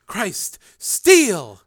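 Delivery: high, loud realization; remembering him with a start; sudden alarm. A narrator's reading. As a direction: Jennifer suddenly remembers Steele. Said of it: "said Jennifer".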